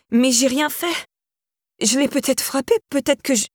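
high, pleading, climbing voice; panicking, pitch climbing as she protests; rising panic. A narrator's reading. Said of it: "entendait sa propre voix monter".

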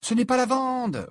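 high-pitched irritated retort; annoyed. Acted. Hermione corrects a hiding Ron, annoyed high retort. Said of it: "répliqua Hermione, agacée".

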